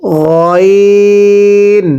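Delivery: গইন said from the middle of the throat, slowly; mid-throat ghain. Shown as a demonstration. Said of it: "গলার মাঝখান থেকে". (গলার মাঝখান থেকে গইন)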